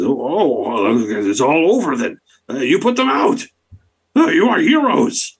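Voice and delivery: high, squeaky frog-folk awe; bullywug voice. Acted. Bullywug gasping to the heroes, high and awed. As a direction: gush like a grateful little swamp creature.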